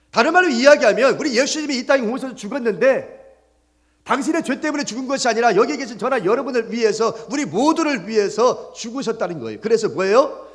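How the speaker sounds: high, loud, driving sermon delivery; pressing the point home to the congregation; full-force preaching. Real, not acted. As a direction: preach it out with rising urgency.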